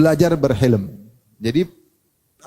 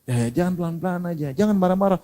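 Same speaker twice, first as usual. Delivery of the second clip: softer, restrained voice of a listener; calm reproof; 5 dB quieter than usual. Acted. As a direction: gently urge someone to calm down. Listener calmly answers the angry man.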